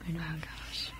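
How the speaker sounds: whispered "oh gosh". Real, not acted. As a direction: breathe it out under your breath. Whispered aside.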